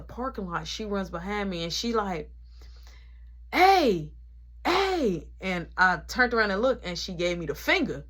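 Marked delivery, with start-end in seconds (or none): 3.5-5.3 high, loud call after someone. Retold or imitated. Nana calls out to stop him leaving, loud. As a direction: shout to stop her leaving.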